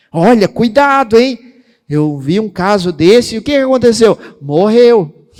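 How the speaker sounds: imitando o fofoqueiro, alto e alarmado; fofoqueiro alarmista. Retold or imitated. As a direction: avisa a notícia ruim em tom alto e dramático. Imita quem traz notícia ruim. (imitação alta de quem espalha notícia ruim)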